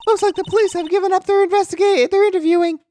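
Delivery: put-on high girlish sidekick voice; young girl voice. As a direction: play the chirpy young assistant. Voices Maya character.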